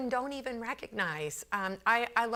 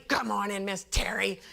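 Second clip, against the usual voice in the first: gruff imitated grouch; grumpy greeting. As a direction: growl the greeting like a cranky coworker. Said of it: "Why are you always so angry".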